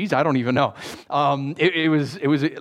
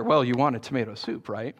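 usual speaking voice

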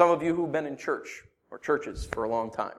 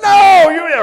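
high, full-volume comic no; mock-outraged shout; retold shouted refusal; 15 dB louder than usual. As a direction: refuse with a hammed-up yell. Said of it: "sorry for yelling at me".

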